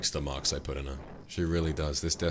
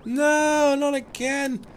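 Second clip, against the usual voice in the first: very high, loud wail; drawn-out shriek of gamer despair; anguished cry; 6 dB louder, much slower than usual. Real, not acted. Loses in the game.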